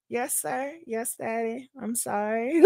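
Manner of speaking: soft, meek little apology, acted out; meek submission. Woman retelling her answer to her yelling man, meek and hushed. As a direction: play obedient and hushed.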